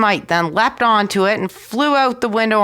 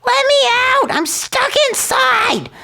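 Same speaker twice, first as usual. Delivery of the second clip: tiny high voice crying out, much higher; shrill cry. A narrator's reading. Tom Thumb inside the cow, crying high and frightened. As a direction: squeal for help like a trapped thumb-sized boy. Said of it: "cried out in a shrill voice".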